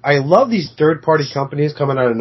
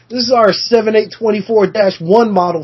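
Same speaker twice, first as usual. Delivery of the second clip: mock product announcer; 7 semitones higher than usual. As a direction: announce it like a toy catalog. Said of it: "They're gonna call it".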